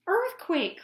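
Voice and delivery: high, loud alarm; alarmed cry. A narrator's reading. The Grunts crying out as the cave shakes, panicked. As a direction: yell the warning in panic. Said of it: "cried the Grunts".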